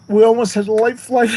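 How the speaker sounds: fighting back real tears on the words; voice breaking. Real, not acted. Telling how close he came to dying, voice cracking. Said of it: "it's just tough to remember these things".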